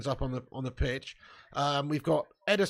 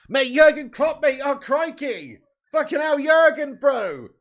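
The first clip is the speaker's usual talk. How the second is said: high, loud hammed-up panic; mock alarm; 8 semitones higher, 6 dB louder, slower than usual. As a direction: yelp as if the manager got flattened. Imagining Klopp injured on sidelines.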